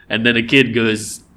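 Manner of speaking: usual speaking voice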